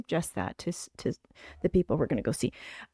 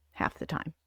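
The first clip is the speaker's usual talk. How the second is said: half the time, breathed out in a whisper; whispered echo; 8 dB quieter than usual. Shown as a demonstration. Whispering it again to her viewers. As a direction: whisper the repeat like an aside.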